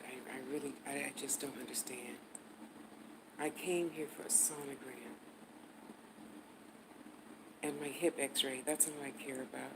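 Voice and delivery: hushed, seething complaint in her mother's manner; mother's angry hush. Retold or imitated. As her mother to the receptionist, hushed and seething. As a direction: keep it low and tight like mom. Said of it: "pretended to be my mother 'cause she whispers when she's angry".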